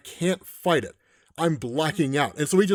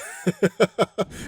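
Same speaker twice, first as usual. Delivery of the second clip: laugh; 3 semitones higher than usual. Real, not acted. Amused at ominous line.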